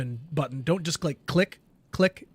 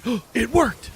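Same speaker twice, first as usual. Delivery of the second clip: mock excitement; 7 semitones higher, 4 dB louder than usual. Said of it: "You'd be like".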